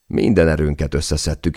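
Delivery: usual speaking voice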